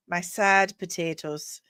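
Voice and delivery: ordinary delivery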